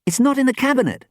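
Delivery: fast, high, loud complaint; rapid high-pitched demand. A narrator's reading. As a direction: announce it urgently and insistently. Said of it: "Sam demanded".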